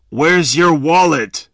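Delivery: loud, rough demand; robber's demand. A narrator's reading. Robber searching victim's pockets, loud demand. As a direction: demand it like a thug.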